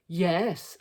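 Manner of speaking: loud answering scream; screamed yes. A narrator's reading. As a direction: yell back at the child, fed up. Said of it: "screamed Dad".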